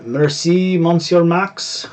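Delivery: bad French accent, slow and high; exaggerated French pronunciation. Shown as a demonstration. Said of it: "with my worst French accent in the world".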